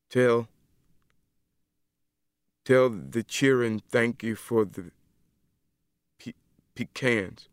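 halting, stumbling over the words; stammering. Acted. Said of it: "he stammered".

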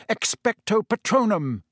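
high, forceful roared spell. A narrator's reading. Harry casting at the Dementors, roaring. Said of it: "whipped out his wand and roared".

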